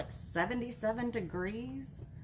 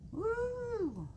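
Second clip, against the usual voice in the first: excited woo; 11 semitones higher, 4 dB quieter than usual. Real, not acted. Cheering about football tomorrow.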